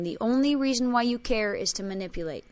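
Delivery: ordinary talk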